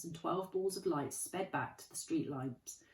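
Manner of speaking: plain reading voice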